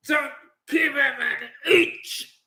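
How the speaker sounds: slow, high, strained shout; fierce command. Real, not acted. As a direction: drag out each word as a fierce order. Fired-up orders to his team.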